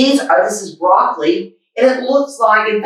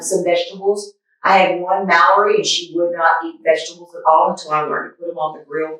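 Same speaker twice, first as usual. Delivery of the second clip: usual speaking voice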